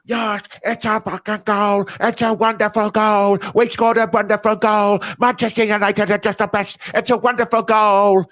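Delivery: mock a rival fan celebrating a goal in an overdone Manc accent; Goldbridge's Manc accent. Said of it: "his faux Manc accent".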